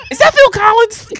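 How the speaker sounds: high mock-baffled question; laughing disbelief. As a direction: ask it in hammed-up disbelief.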